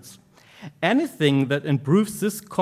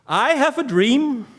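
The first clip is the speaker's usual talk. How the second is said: high, louder, ringing declaration; declaiming orator; 8 semitones higher, 4 dB louder than usual. Acted. Speaker repeating his dream to the audience, declaimed high. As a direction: proclaim it like a grand speech.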